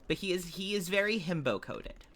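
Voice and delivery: ordinary talk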